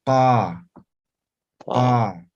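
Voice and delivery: deepest in the throat, "Pa"; postvelar q sound. Shown as a demonstration. Teacher shows the class the postvelar sound written "Pa". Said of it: "Ahora postvelar es aquí".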